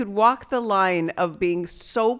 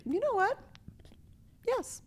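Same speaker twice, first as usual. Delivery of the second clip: high mock girl voice; 13 semitones higher, 9 dB quieter than usual. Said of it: "And she's like".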